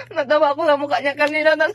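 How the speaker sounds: loud laughing speech; words through laughter. Real, not acted. Laughing at partner's face. (natatawa habang nagsasalita)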